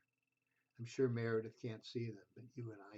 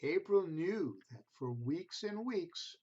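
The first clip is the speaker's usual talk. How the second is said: gentle storybook reading voice; reading to children; 3 dB louder than usual. A narrator's reading. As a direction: read it like a bedtime picture book. Reads picture book to children.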